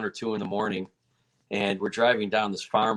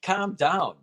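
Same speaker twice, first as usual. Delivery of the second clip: urgent pleading, pitched high; mock yell to rein someone in, voice raised in pitch; retold yelling; 9 semitones higher than usual. Teammates telling the fighter to calm down abroad, retold yelling. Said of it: "we're yelling".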